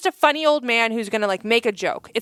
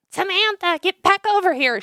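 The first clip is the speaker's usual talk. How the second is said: put-on high, sharp call to a wandering child; a mom's high call across the store; 9 semitones higher than usual. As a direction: call the kid back like a fed-up mom. Mom calling her child back.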